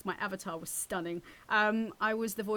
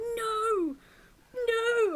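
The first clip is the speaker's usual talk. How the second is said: much higher, loud cry of disbelief; disbelieving cry; 9 dB louder than usual. Retold or imitated. Cousin realizing she voiced his game, crying out high and loud. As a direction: cry out like your mind is blown. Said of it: "he was just like".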